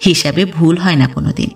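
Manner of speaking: ordinary delivery, nothing marked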